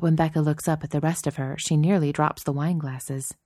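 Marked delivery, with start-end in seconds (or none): none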